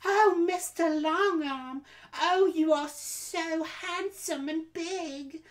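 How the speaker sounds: mock a bad voice actor gushing over his hero; a terribly faked breathless admirer, drawn out and high; worst fake voice. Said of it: "the worst fake voice".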